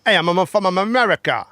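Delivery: hammed-up American accent; better American accent. Shown as a demonstration. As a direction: push the American accent harder. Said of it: "So that's better then".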